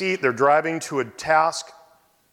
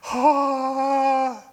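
shocked gasp-like ah; 12 semitones higher than usual. Retold or imitated. Reacting to cancer news.

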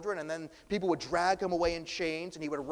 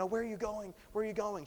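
anxious healed man; 7 semitones higher, faster than usual. Retold or imitated. Healed man voiced, Jesus leaving.

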